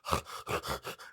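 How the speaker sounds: voiceless, noisy pug panting; wheezy mock dog breaths; loud pug breathing. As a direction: breathe loudly like a pug instead of talking. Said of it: "He just breathes really loud".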